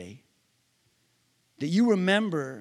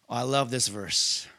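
ordinary talk, nothing marked

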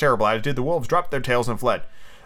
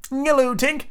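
put-on high-pitched boyish greeting; acted children's hail; crying out; 11 semitones higher than usual. A narrator's reading. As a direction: call up to a friend in a high voice. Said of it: "cried the wandering boys".

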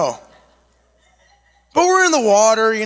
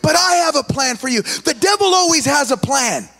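preaching; 5 semitones higher than usual. Acted. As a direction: drive the lesson home to the congregation. Preaching the message.

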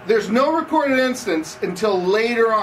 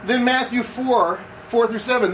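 ordinary talk, nothing marked

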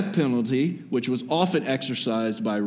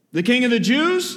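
much higher, louder, sneering rise; mocking question; 10 semitones higher, 4 dB louder than usual. Pilate taunting the crowd about their king, high and mocking. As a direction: needle the crowd with the title.

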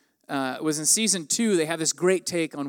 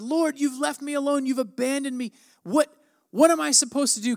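high, pleading cry to God; desperate plea; 8 semitones higher than usual. Acted. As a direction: sound abandoned and lost. Nathanael crying out to God.

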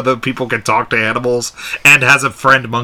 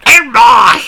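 shouted, much higher mock explorer voice; Nigel Thornberry; 12 semitones higher, 9 dB louder than usual. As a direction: yell it like a cartoon explorer. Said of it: "Like Nigel Thornberry had a stroke".

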